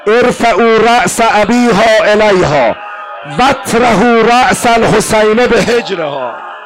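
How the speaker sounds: shouting the command from the throne; Yazid shouting. A narrator's reading. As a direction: bellow the order like a cruel tyrant. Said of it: "یزید حرومزاده داد زد".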